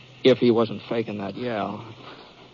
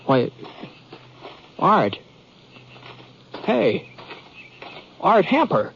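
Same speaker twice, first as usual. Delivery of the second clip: surprised recognition; 7 semitones higher, slower than usual. Acted. Recognizing an old friend.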